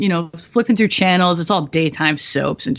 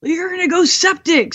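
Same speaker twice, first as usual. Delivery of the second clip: freaked out; 10 semitones higher than usual. Retold or imitated. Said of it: "everybody freaked out".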